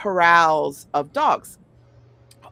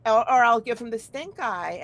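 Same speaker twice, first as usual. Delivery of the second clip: ordinary delivery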